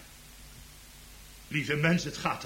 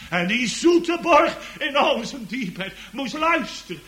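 loud, high proclamation, gradually coming down; impassioned preaching; 11 semitones higher, 10 dB louder than usual. Real, not acted. Preacher tells congregation of manger and cross, impassioned. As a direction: cry out the gospel, then settle toward solemnity.

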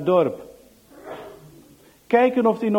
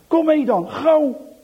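high, loud hurry-up call; urgent call; 7 semitones higher, 7 dB louder, slower than usual. Acted. Piet urging Johan to come along quickly, high urgency. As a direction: get them moving now. Hurried flight decision.

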